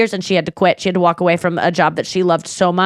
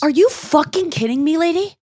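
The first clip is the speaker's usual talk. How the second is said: high, indignant outburst; shocked, angry disbelief; outraged disbelief; 8 semitones higher than usual. Acted. Outraged at the plane passenger.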